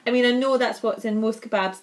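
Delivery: normal talking voice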